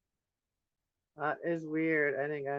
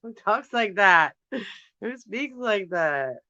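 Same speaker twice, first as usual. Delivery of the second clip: loud, higher, drawn-out incredulous question with a laugh; amused disbelief; 4 semitones higher, 7 dB louder, slower than usual. Real, not acted. Vlogger baffled by her own accidental accent, loud amused disbelief. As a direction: exclaim it slowly, laughing at yourself.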